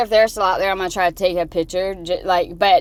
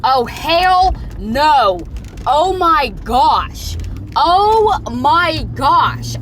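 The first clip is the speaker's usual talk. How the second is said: slow, drawn-out, louder and higher exclamations; appalled groan of oh my gosh, pitched up; outraged disbelief; 5 semitones higher, 5 dB louder, much slower than usual. Real, not acted. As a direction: stretch each word out in real disbelief. Outraged at sight while driving.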